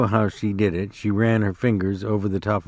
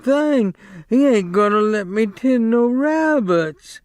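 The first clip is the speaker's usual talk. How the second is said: much higher, slow frightened pleading; begging in fright; 10 semitones higher than usual. A narrator's reading. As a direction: beg in fear, higher and slow. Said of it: "he begged".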